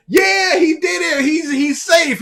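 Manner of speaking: high, loud relieved whoop; relieved cheering. Retold or imitated. Friends at midnight cheering that he survived, loud and high. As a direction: cheer like the danger just passed.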